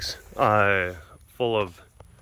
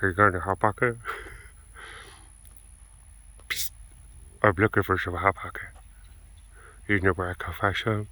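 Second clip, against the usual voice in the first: mock furtive whisper; 4 semitones lower, 7 dB quieter than usual. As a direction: ask it low and secretive. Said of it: "that's why I'm whispering".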